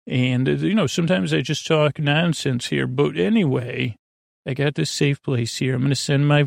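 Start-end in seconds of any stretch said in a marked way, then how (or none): none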